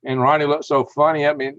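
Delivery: ordinary talk, nothing marked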